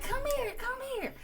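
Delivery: high, teasing call of schoolkids; mocking kids' voice. Classmates teasing the new girl: high mocking call. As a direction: mimic classmates calling her over, high and taunting. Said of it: "they be like".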